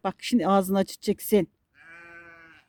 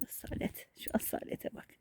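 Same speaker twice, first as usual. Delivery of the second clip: breathy whisper, lips close to the mic; soft whispered praise; whispered admiration; 15 dB quieter than usual. Real, not acted. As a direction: whisper in awe at the dog. Admiring dog under her breath.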